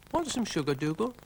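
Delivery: put-on high, kindly girl voice; Florence. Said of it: "said Florence".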